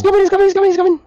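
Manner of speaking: panicked shouting. Real, not acted. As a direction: shriek the warning in panic. Monster approaching.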